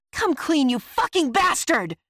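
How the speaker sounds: loud, high-pitched angry shout; furious demand. A narrator's reading. Narrator demands the shocked Roslo confess, furious shout. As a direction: explode at the traitor.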